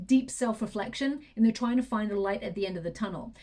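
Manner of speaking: ordinary talk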